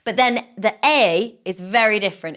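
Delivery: ordinary talk, nothing marked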